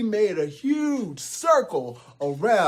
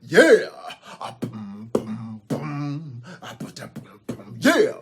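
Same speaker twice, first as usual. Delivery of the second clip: beatbox hype shout; 2 semitones lower than usual. Acted. Grande Gruff beatboxes for the troll, shouting hype.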